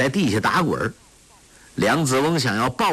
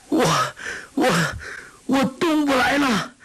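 high, stammering yell of panic; terrified cry. Acted. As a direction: scream it as the body stops obeying. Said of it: "忽然大叫". (惊恐结巴地尖声大叫)

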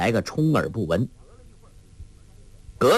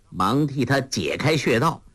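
ordinary delivery

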